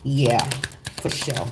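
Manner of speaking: ordinary talk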